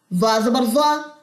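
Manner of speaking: careful letter-by-letter recitation; teacher spelling out letters for the class; spelling drill. Shown as a demonstration.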